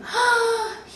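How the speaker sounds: loud, high intake of breath; excited gasp. Acted. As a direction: gasp in thrilled surprise. Man thrilled by God's promise.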